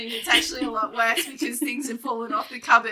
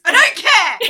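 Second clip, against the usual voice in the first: louder, higher shout of DON'T; mock inner shout; 8 semitones higher, 12 dB louder than usual. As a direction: bark it at yourself in mock rage. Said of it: "I'm like".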